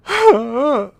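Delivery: loud, high exasperated huff; big exasperated sigh. Real, not acted. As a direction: let out a fed-up sigh. After acting Cisco's rant.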